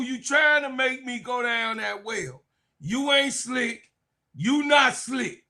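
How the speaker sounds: high-pitched mock protest; teasing, high-voiced refusal to be baited; playful calling-out. Real, not acted. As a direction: pitch it up, you're onto his trick. Playfully calling out a baiting viewer.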